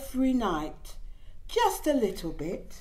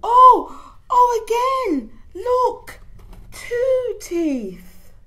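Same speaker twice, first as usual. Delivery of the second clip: excited squeal; 13 semitones higher, 10 dB louder, much slower than usual. Acted. Baby excited by teeth.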